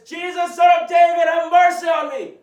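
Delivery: very loud, high pleading shout. Acted. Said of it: "he cried out all the more".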